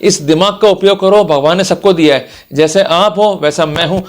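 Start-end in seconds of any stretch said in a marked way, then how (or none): none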